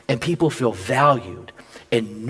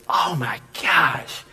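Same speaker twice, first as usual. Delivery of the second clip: breathy hushed whisper of wonder; awed whisper; 3 semitones lower, much slower than usual. Real, not acted. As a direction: whisper it in awe. Awed whisper after the story.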